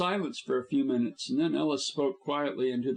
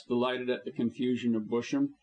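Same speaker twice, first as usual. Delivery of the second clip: usual speaking voice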